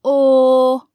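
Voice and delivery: "o" held as a long, level aw sound; long vowel. Shown as a demonstration. Teacher isolating the vowel of pour, "o" held long as aw. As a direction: sustain "o" as aw, not the letter name. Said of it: "则发长元音".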